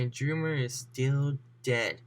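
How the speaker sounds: ordinary delivery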